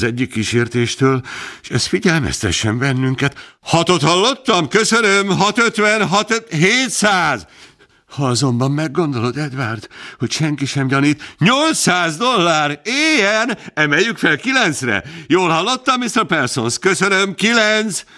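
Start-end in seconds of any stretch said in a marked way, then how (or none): 3.6-7.5 high, lively auctioneer calling the bids; auctioneer's patter. A narrator's reading. Halliday works the crowd, raising the bids, lively high auctioneer patter. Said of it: "Halliday hangja". 11.4-18.0 high, lively auctioneer calling the bids; auctioneer's patter. A narrator's reading. Halliday works the crowd, raising the bids, lively high auctioneer patter. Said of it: "Halliday hangja".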